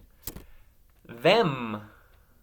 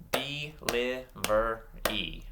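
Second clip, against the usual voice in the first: DELIVERY slowly, a gap after every syllable; much slower than usual. Shown as a demonstration. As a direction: clap out "Delivery" piece by piece. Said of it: "clap out some syllables".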